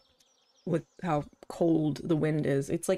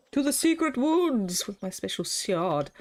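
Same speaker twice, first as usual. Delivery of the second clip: full-voiced playful quest call; mock-heroic proclamation; 3 semitones higher than usual. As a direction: announce it like a storybook adventurer. Same words now proclaimed aloud.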